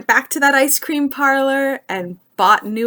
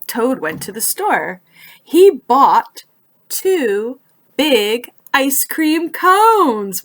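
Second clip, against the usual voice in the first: lively storybook narration, rising higher as the story heats up; reading to children; 5 semitones higher, 4 dB louder than usual. A narrator's reading. Librarian reading Frog and Toad to young listeners, animated storybook voice. Reads picture book to children.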